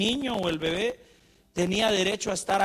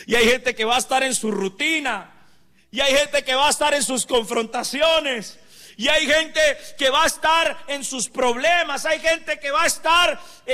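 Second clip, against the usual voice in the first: builds louder and higher line by line; rising preaching; 8 semitones higher, 8 dB louder than usual. Acted. Preacher declaring God's favor to the believers, building loud preaching. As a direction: stir the congregation toward a climax.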